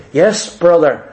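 low, reluctant-sounding acceptance; resigned willingness. Acted. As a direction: drop the pitch and agree to help. Acts his reply to enemy.

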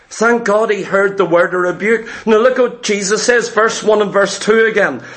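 ordinary talk

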